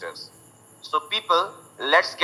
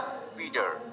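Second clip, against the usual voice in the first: American "पीटर" with t turned to d; American flapped t and sounded final r; American accent; 8 semitones lower, 15 dB quieter than usual. Shown as a demonstration. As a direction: say "पीटर" American-style, as PEE-derr. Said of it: "American accent वालों का".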